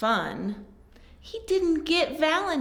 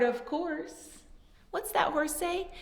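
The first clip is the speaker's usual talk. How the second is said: usual speaking voice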